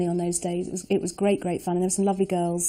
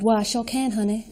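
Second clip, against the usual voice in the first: put-on American Southern accent. Nurse auditioning for the director, American Southern accent. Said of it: "Can you do a Southern accent".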